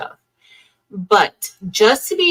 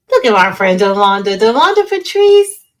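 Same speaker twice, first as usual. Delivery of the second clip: calling out a friend; 8 dB louder than usual. Real, not acted. Hyped greeting of a viewer friend.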